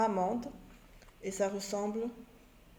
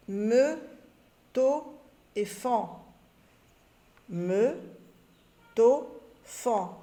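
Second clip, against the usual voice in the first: me, to, fan called out loud and separate; syllables called loud; 4 dB louder, much slower than usual. Shown as a demonstration. Teacher reading me, to, fan to pupils, loud. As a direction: call each syllable out loud. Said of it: "reading syllables to pupils".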